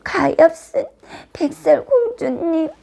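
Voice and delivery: high, tearful lament; acted sobbing sorrow; grieving dwarfs. A narrator's reading. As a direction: mourn the princess in a crying voice. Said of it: "몹시 슬퍼했어요".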